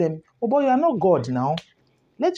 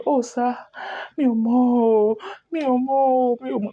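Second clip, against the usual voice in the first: higher, wailing plea, lightly acted; mock crying; 5 semitones higher than usual. Young man wailing to the learned man, mock crying. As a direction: sob out the name to the elder.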